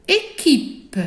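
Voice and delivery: ordinary talk, nothing marked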